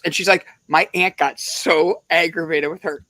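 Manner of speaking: ordinary delivery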